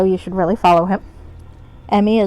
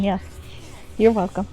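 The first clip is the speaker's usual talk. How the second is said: ordinary talk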